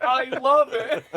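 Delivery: high, slow, gleeful reply; laughing enthusiasm. Acted. Listener jokes about answering Billy Graham, laughing. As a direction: gush it through laughter.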